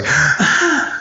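child's panicky gasp, acted; high mock gasp; imitated hyperventilating gasp. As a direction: suck in air like a hyperventilating kid. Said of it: "like that hyperventilating eight-year-old".

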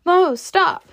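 high, loud call to halt someone. A narrator's reading. Scarlet calls to the boy through the crowd, urgent shout. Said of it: "and called".

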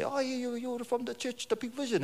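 high, soft hoped-for answer; imagine him saying it, high and light; the pastor's dreamed reply in a high voice; wished reply. Pretend. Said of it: "I wanted him to say".

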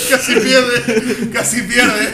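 laughing. Real, not acted. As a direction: crack up as you say it. Cracking up over the joke. (entre carcajadas)

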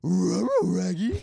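loud, stretched cartoon dog-style voice; cartoon impression. Friend plays the cartoon sidekick, loud and drawn out. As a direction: call it out big, slow and high.

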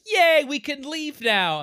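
high, loud, slow cheering; excited cheer. Retold or imitated. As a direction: cheer like freed kids. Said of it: "they're all like".

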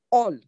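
"All" set apart alone after a pause; stress on all. Shown as a demonstration. Preacher underlining the promise to the congregation, "All" stressed alone. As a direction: give "All" its own emphatic beat. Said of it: "Emphasis on the word all".

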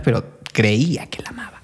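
hushed whisper; whispered confidential aside. Real, not acted. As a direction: drop to a secretive whisper. Conspiratorial aside.

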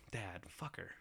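quiet bitter mutter; muttered curse. Retold or imitated. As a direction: mutter it under your breath. Muttering alone at lunch.

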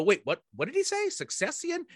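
fast puzzled mutter, then succession rising high; confused audience member. Retold or imitated. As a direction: play a baffled listener, then pitch succession way up. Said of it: "an audience member just stumble, to go".

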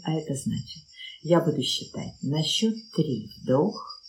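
ordinary talk, nothing marked

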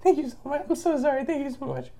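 squeaky high thank you; high grateful child voice. Retold or imitated. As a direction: gush thanks like a small kid. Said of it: "and like".

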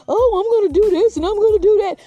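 high loud mocking whine; mock them in a silly voice. Mocking people who don't know themselves.

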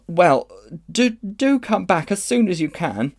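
put-on high, urgent child's plea; pleading boy. A narrator's reading. Diggory begs Polly to return, urgent pleading. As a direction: beg her earnestly, high and stammering.

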